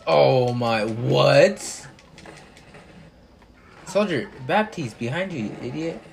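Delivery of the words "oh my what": raging gamer. Real, not acted. Raging at game.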